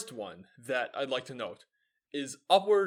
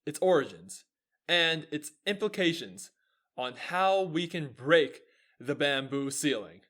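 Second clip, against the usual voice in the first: big, punchy talk-show intro; show intro announcer; 8 dB louder than usual. Acted. Host teasing tonight's show to listeners, announcer style. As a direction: build to a loud, drawn-out show title.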